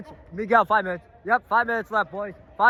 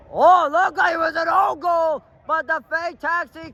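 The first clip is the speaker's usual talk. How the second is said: excited call; 6 semitones higher than usual. Real, not acted. Commentator reacts to own goal.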